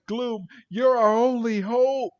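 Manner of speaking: high, hammed-up appeal; melodramatic plea. Podcaster mimics Brock begging Gloom, melodramatic. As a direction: plead as if all is lost.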